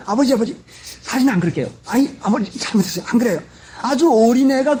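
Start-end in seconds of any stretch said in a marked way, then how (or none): 0.1-3.4 put-on a frightened little child begging, pitched up; mock pleading of a scared child, voice higher; trembling child pleading. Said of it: "발발 떨면서". (겁에 질린 아이처럼 흉내 내며 비는 목소리)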